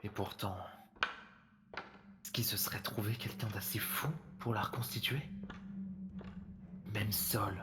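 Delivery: hushed, disbelieving murmur to himself; barely voiced, as if he can't believe it; stunned murmur. Alone in the rebuilt classroom, a stunned hushed murmur.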